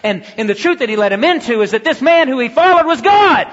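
high, loud sermon voice building to a shouted peak; preaching at full force. Real, not acted. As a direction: build to a climax on the final word. Preaches to a climax.

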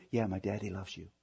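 lightly voiced child answering daddy; child's reply. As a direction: mumble it back like a small child.

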